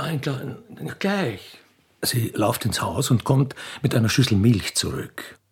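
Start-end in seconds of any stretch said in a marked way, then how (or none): 2.0-5.4 lower, louder narrator reading; stage directions read. A narrator's reading. Narrator reads out the arrival of the royal train, stage voice. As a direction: announce the scene like a stage narrator.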